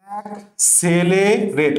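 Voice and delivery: অ্যাক্সেলারেট very slowly, in separated syllables; slow syllable breakdown. Shown as a demonstration. Teacher showing how to read it without mistakes, syllable by syllable. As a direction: sound out অ্যাক্সেলারেট with long syllables. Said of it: "এভাবে পড়লে আর জীবনে ভুল হবে না".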